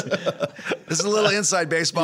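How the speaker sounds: giggling as the punchline lands; laughing. Real, not acted. Joking about Taro being triplets, laughing. Joke about three Taros.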